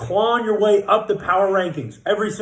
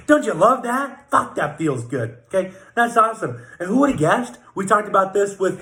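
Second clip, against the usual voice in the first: ordinary talk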